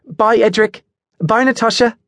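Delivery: very high, singsong cheery goodbye; cheerful trill. A narrator's reading. Adelaide says goodbye to Edric and Natasha, cheerfully trilling. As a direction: trill farewells without a care. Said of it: "trilled cheerfully".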